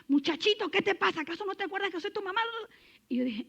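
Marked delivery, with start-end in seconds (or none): 0.1-2.4 regaño de mamá imitado. Retold or imitated. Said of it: "Y yo tuve ganas de".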